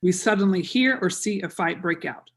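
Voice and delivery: normal talking voice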